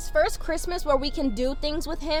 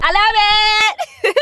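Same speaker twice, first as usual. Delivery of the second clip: gushing; 8 semitones higher, 8 dB louder, much slower than usual. Real, not acted. Gushing over new camera.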